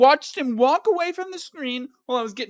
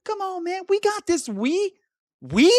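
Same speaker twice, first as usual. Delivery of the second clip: higher, hyped-up gamer pep talk; lightly mocking a buddy cheering him on; hammed-up encouragement; 7 semitones higher than usual. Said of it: "He started saying".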